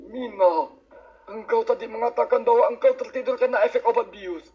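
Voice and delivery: ordinary talk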